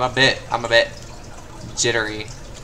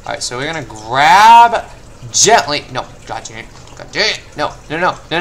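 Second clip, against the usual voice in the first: ordinary talk, nothing marked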